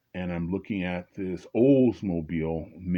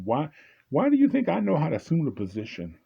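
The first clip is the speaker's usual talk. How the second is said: indignant outrage; 8 semitones higher, 3 dB louder than usual. Real, not acted. Indignant rant.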